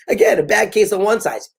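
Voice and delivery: normal talking voice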